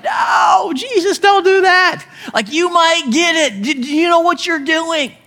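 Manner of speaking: high, urgent mock warning; a follower fretting as Jesus nears the leper, alarmed; alarmed protest. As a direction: pull him back in alarm.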